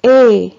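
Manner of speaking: "E" said as one pure vowel, held; letters sound as one. Shown as a demonstration. Teacher shows pupils what A and I give together, one held vowel "E". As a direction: say "E" as a single sustained vowel for A-I. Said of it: "ça donne".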